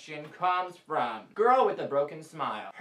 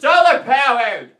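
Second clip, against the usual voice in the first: insistent shout; 5 semitones higher, 12 dB louder than usual. Acted. Insists on his answer.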